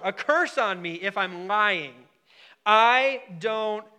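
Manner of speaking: slow, loud oath, building; sworn emphatic denial. Acted. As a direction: act out swearing it with rising force. Peter swears, acted denial escalates.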